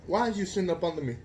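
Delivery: ordinary delivery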